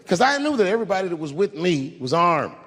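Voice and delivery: usual speaking voice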